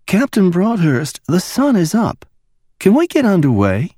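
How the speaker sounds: high, soft woman's voice for Mickey; a lightly hushed ask up high; hushed request; quiet Mickey. A narrator's reading. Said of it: "she asked quietly".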